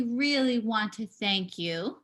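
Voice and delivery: normal talking voice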